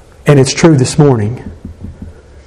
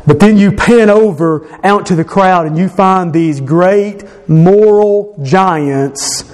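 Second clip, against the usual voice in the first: usual speaking voice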